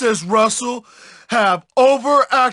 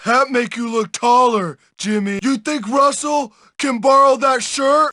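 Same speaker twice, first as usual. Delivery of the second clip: ordinary talk, nothing marked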